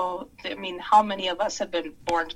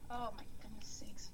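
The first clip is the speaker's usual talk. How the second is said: breathless exasperated whisper; 16 dB quieter than usual. Real, not acted. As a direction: whisper it under your breath. Exasperated aside after robot.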